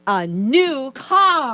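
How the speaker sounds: high, slow, loud prize call; game show announcer. Shown as a demonstration. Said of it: "the way you would say it on a game show".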